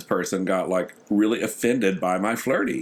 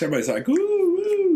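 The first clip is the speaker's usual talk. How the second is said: high-pitched imitation "ooh, ooh"; mimicked crowd oohs; 3 dB louder than usual. Retelling how everybody reacted as they walked through, high mock oohs.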